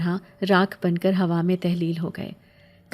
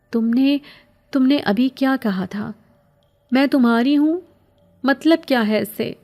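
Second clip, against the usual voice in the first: higher and louder, halting then pressing; daring question; 6 semitones higher, 4 dB louder than usual. A narrator's reading. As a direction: gather courage and demand an answer. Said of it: "ہمت کر کے پوچھا". (ہمت کر کے اونچی آواز میں سوال)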